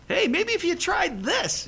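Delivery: high, far-off mocking taunt; sarcastic jeer at the prophets. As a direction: taunt them from up on the mountain. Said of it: "sits up there and mocks them".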